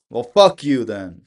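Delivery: loud, slow, fed-up curse; angry. Real, not acted. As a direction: spit it out at the dead line. Hung up on, swearing at phone.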